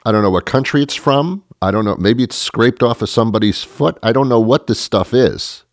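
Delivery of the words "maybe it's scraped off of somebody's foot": ordinary talk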